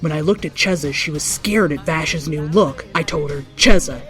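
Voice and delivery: plain reading voice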